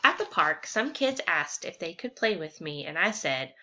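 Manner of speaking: lively picture-book read-aloud; reading to children. Acted. Teacher reading a story to fifth graders, lively and clear. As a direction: read it out to the class with energy.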